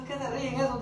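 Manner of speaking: a quiet, grumbling aside to himself; soft, sour inner mutter; muttered inner complaint. Retold or imitated. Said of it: "decía yo".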